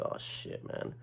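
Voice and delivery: whispered oh-no aside; hushed dismay. Retold or imitated. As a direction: breathe it out under your breath. Dismayed thought as fight starts.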